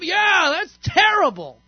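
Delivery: emphatic sympathy. Shown as a demonstration. Teacher modeling a heartfelt response to an angry friend, emphatic. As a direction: throw real feeling into the reply. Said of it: "some kind of emotional response".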